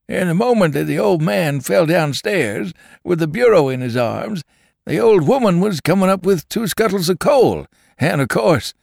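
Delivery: a jocular travelling drummer telling a funny story; drummer's tale. Acted. Drummer entertains the saloon with a tale, high and jocular.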